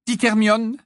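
plain reading voice

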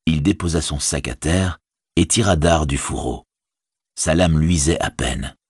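usual speaking voice

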